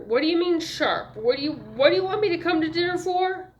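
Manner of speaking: harried, indignant man; exasperated questioning. A narrator's reading. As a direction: demand an explanation, getting worked up. He objects to the invitation.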